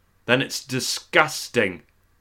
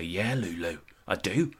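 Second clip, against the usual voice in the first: soft, low answer; soft reply; 5 semitones lower, 12 dB quieter than usual. Pretend. [name] answers Lulu softly in the jacuzzi. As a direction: murmur it back. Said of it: "And I'd say".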